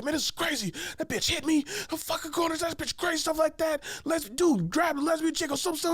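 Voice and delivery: high-pitched ranting imitation; hella mad friend. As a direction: rant on and on in a worked-up high voice. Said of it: "he's all, like, hella mad".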